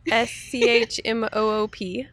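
S C H M O O P spelled out letter by letter; spelling it out. Shown as a demonstration. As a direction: spell out S C H M O O P slowly, one letter at a time. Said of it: "Spell that for us?".

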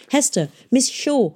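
high, urgent call. A narrator's reading. Boy wading after the girl, calling out. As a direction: call after someone walking away.

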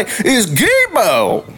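slow, drawn-out Southern "Gibo"; clarified Southern accent. Acted. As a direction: spell out "Gibo" slowly in Southern twang. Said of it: "my southern accent gets a little too in the way".

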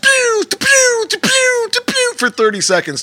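high squeaky pew sound effects; pew pew firework noises. Retold or imitated. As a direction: mimic fireworks popping in the sky.

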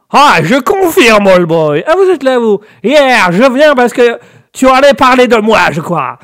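put-on loud, high American-accented caricature; American character. American alter ego interrupts the host, loud American accent. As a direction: burst in as a brash American, drawling and over the top. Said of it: "Ah, je parle en américain".

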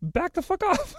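very high mock "back off"; very high mock irritation. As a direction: squeak a fake warning. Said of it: "And I was like".

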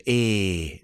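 imitating a Geordie nan's "Eh"; Geordie accent. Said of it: "heavily Geordie accent".

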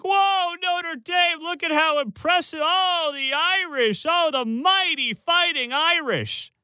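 high, slow mock gushing over the Irish; sarcastic starstruck fan voice; mock awe. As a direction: fake gush, way up high and drawn out. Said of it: "we're supposed to jump for joy and be like".

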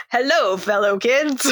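slow, hammed-up hello fellow kids; fake cool adult. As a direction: play the awkward grown-up posing as a teen. Meme voice of fake teen.

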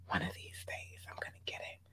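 soft secretive whisper. Acted. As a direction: whisper it like a private promise. Whispered aside to viewers.

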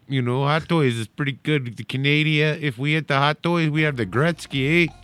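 broad put-on Canadian accent; mock Canadian accent. Host plays a Canadian toy podcaster, mock Canadian accent. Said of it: "what if there was a Canadian toy podcast".

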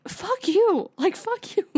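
high-pitched, exasperated dismissal; indignant cursing. Acted. Viewer cursing the cheating couple on screen, indignant. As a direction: throw the curse out high and incredulous.

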